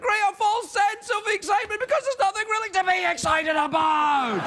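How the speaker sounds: hammed-up host shouting; fake excited shouting. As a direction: keep shouting with false excitement. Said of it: "I'm shouting for absolutely no reason".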